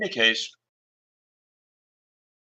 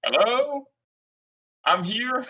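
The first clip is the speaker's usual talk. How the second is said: high call; 11 semitones higher, slower than usual. Acted. Calling out to stranger.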